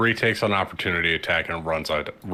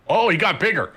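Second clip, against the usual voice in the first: surprised shout; 11 semitones higher, 5 dB louder, faster than usual. Real, not acted. Reacting to the ogre growing.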